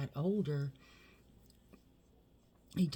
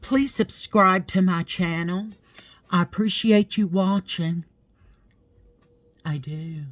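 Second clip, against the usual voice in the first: higher, much louder youtuber sign-off; loud outro plug; 5 semitones higher, 12 dB louder than usual. Real, not acted. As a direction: belt out the subscribe pitch.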